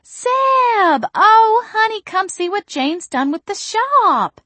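high, loud shrieked greeting, slow at the end; calling out. A narrator's reading. Mother calling Zeb over to see the shop, high and loud. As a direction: call him over with delight.

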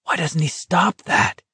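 strained, high-pitched undertone; muttered distress. A narrator's reading. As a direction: mutter it, appalled by the screaming. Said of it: "Garrity muttered".

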